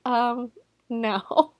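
hesitate, then let the no out awkwardly; awkward denial. Retold or imitated. Phil staring right at Hank, awkward denial.

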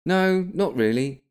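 put-on high, slow boy voice; thirteen-year-old boy. A narrator's reading. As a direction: answer as a doubtful youngster, high and drawn out. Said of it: "thirteen-year-old William had said".

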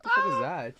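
high shocked yelp; startled. Real, not acted. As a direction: jump at a sudden scare. Sees a spider.

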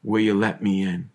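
a hint of Jesus asking, low and soft. Preacher voicing Jesus to listeners, low and hushed. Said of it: "of Jesus saying".

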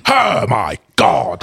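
slow, drawn-out mock gasp; put-on commenter voice. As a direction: stretch it out like a shocked prude. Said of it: "listen to the voice you're putting".

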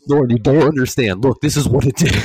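high, loud insistent pleading; hammed-up insisting to his wife; pushy reenacted demonstration; insistent mock reenactment. Replaying insistence to Lauren.

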